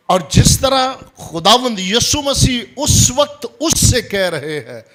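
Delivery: loud, high pulpit voice; forceful preaching. Real, not acted. Preacher retells Jesus addressing the scribe, loud and high. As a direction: raise the voice to press it home.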